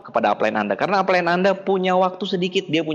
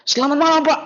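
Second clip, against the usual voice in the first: cheerful phone greeting; 10 semitones higher, 3 dB louder than usual. Retold or imitated. Cheerful downline calling.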